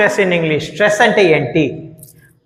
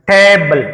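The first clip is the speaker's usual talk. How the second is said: first syllable of table punched louder, second left weak; stress on first syllable; 4 dB louder, slower than usual. Shown as a demonstration. Teacher showing students how to pronounce table, first syllable stressed. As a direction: hit the opening టే of table hard. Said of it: "టా పలికినంతగా ఈ బిఎల్ఈని పలకం".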